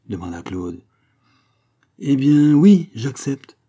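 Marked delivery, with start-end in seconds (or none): 2.0-3.6 loud, high, drawn-out acceptance; carried-away enthusiasm. A narrator's reading. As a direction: say yes in a slow, swelling burst of enthusiasm. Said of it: "emporté par son enthousiasme". (emporté par l'enthousiasme, voix haute et forte)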